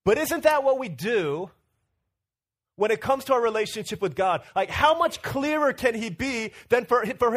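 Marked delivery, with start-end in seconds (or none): none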